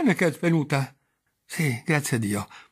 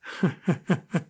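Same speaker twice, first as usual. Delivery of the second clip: sudden breathless giggle she can't stop; nervous unwilling laughter; hysterical laugh; 3 semitones higher than usual. Acted. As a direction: laugh as if it escapes her. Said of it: "Chi è che ride?".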